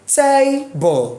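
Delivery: TABLE with TA held high, BLE stepping down; TABLE, high first syllable then a drop; stress on first syllable. Shown as a demonstration. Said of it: "take your hand up".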